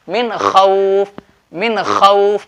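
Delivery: slow मिन खौफ, N clear, खौफ drawn out; measured model of मिन खौफ, distinct noon; izhar pronunciation. Shown as a demonstration. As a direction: keep मिन free of hum and stretch खौफ. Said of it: "यहाँ इजहार होगा".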